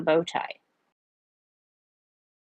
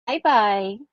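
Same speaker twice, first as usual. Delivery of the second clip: higher, sing-song goodbye; lilting, playful sign-off; 4 semitones higher than usual. Acted. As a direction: sing the farewell lightly. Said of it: "said in a sing-song way".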